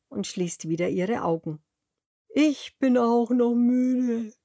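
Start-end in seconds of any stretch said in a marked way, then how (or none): 2.3-4.3 slow, yawned-out tired words; yawning cow. A narrator's reading. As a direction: stretch it out through a yawn. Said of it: "gähnt Carla die Kuh".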